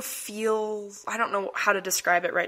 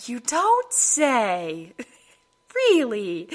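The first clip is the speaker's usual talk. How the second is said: slow sarcastic drawl; dripping with sarcasm; much slower than usual. Retold or imitated. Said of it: "My sarcasm came out full force".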